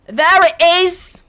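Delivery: high, drawn-out shout; loud exclamation. Real, not acted. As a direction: belt it out slowly and triumphantly. Repeats it as a big exclamation.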